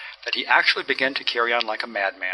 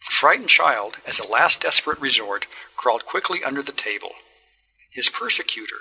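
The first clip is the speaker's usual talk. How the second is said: usual speaking voice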